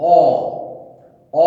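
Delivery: অ said slowly with the mouth half open; হালকা খোলা মুখে অ, টেনে বলা; vowel demo. Shown as a demonstration. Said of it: "অ উচ্চারণ করলে". (অর্ধবিবৃত অ-এর উচ্চারণ দেখানো)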